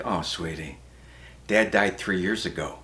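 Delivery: low, hushed, tender voice; mock-tender, quiet consoling; gentle mother. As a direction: break bad news softly to a child. Mother gently breaking sad news.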